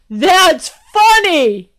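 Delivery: high, loud, slow guard voice; guard laughing it off. Acted. Guard telling the princess her joke works, high slow voice.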